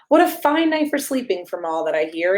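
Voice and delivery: usual speaking voice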